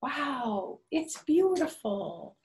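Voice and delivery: high, drawn-out wow of amazement; delighted wonder. Acted. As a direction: marvel at the fireworks like a child. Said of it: "It says".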